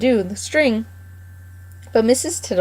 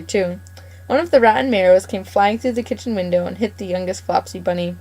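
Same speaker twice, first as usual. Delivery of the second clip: usual speaking voice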